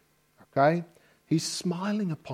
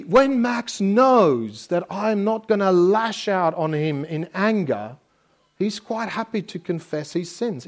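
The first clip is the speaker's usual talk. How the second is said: normal talking voice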